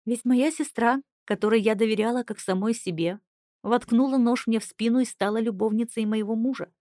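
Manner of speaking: ordinary delivery, nothing marked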